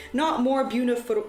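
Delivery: plain reading voice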